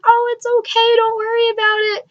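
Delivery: high-pitched imitated reassurance; acted kindly there-there, very high; reassuring. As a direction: soothe a nervous fan, voice lifted way up. Retells idol reassuring nervous fan.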